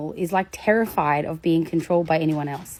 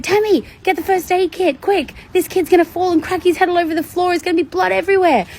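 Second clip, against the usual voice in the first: much higher, louder staged alarm; fake panic; 12 semitones higher, 5 dB louder than usual. Speaker fakes alarm at climbing kid, high panicked call. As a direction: put on a panicked show to scare the kid. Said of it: "you saw me kind of freak out".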